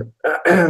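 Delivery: hard rasping throat clear; harsh throat clearing. Real, not acted. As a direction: clear water from your throat. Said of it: "excuse me".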